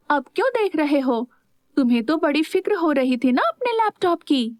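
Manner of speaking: understated miffed complaint; glaring reproach. A narrator's reading. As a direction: needle them with a touch of jealousy. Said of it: "नील को घूरते हुए बोली".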